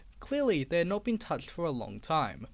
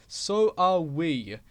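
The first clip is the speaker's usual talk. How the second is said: louder, slow hissing retort; hissed; 7 dB louder, much slower than usual. Acted. Engines turning on Duck, slow hiss. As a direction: hiss it back. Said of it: "hissed the engines".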